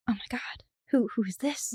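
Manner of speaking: whispered astonished diner; hushed awe. Acted. Diners seeing waiter land, whispering in awe. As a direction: gasp it softly, under your breath.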